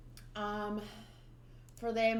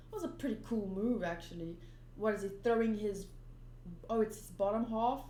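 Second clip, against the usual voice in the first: ordinary talk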